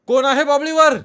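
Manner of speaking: very high, straining shout; all-out yell. A narrator's reading. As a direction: shout with all your might. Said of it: "पुन्हा जीव खाऊन ओरडला".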